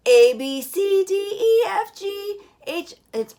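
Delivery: breathless strained alphabet; running out of breath. Shown as a demonstration. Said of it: "If I just take a very shallow breath".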